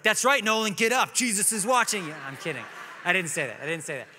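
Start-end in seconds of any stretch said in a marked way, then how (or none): 0.0-2.1 mock stern dad. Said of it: "And I was like".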